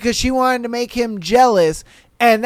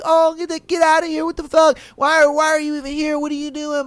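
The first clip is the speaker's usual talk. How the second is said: imitated angry girlfriend; 5 semitones higher than usual. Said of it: "these two are just fighting it out".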